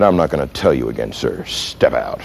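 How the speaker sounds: low, quiet ultimatum; final warning. Retold or imitated. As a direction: drop deep and soft for the last order. Officer's final warning to driver.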